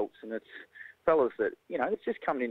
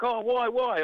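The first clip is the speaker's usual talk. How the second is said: pitched way up, mock puzzled why; puzzled colleague; 11 semitones higher, 3 dB louder, slower than usual. Colleagues asking why the meeting must be Tuesday, mock puzzled high. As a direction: play the baffled colleague questioning the Tuesday. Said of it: "they're like".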